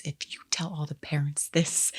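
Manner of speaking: hushed conspiratorial whisper. Retold or imitated. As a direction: lean in and whisper it. Principal whispering aside to sheriff.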